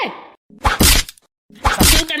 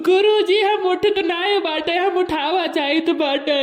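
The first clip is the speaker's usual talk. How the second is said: whiny, much louder blubbering; mock crying; 3 dB louder than usual. Kid blubbers his wake-up excuse to the teacher, mock crying. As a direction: blubber your answer to the teacher. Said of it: "रोवे के लिए नहीं बोले भाई".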